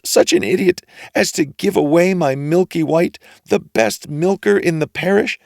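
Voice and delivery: high, loud scolding tirade; mother scolding Jack over the beans; furious mother. A narrator's reading. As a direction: berate the foolish boy.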